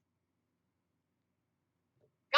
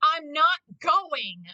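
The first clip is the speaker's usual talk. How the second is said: slow, louder stubborn Vegeta; 4 semitones higher, 7 dB louder, much slower than usual. Retold or imitated. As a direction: drag out the refusal. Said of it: "And he's like".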